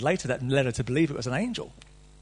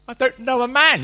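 higher, louder protest; young Mary protesting to the angel; incredulous Mary; 8 semitones higher, 7 dB louder than usual. Retold or imitated. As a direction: protest in disbelief. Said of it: "the first question she asked".

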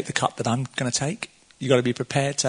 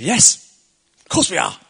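thundered reply; 5 semitones higher, 5 dB louder than usual. Retold or imitated. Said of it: "Thundered, the reply".

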